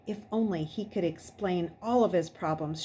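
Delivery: usual speaking voice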